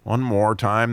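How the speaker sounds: ordinary delivery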